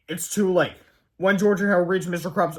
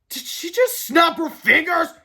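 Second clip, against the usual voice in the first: slow, high, loud disbelief; alarmed question; 12 semitones higher, 6 dB louder, slower than usual. A narrator's reading. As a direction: realise the danger with dread. Harold alarmed by finger snap.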